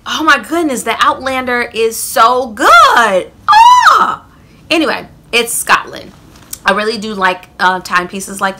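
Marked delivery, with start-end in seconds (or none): 0.1-3.3 excited gushing. Real, not acted. Gushing about a favorite show. 3.5-4.3 very high loud delighted shriek; excited squeal. Real, not acted. Vlogger fangirling over Outlander, high squeal. Gushing about a favorite show.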